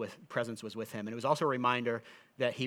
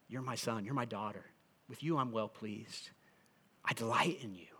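tender blessing; 3 semitones lower, 8 dB quieter than usual. Acted. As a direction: speak it gently to each listener as God. God's blessing spoken to listeners.

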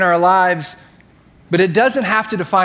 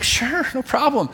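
high, drawn-out mock agreeable reply; obliging friend; 8 semitones higher, slower than usual. Contractor friend agreeing to lend a saw, imitated obliging voice. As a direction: imitate an easygoing yes, slow and high.

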